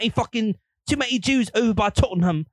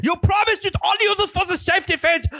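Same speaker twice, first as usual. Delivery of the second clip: mock angry scold; 9 semitones higher than usual. Hitler scolding his girlfriend, high and whiny. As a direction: rant at her like a betrayed boyfriend.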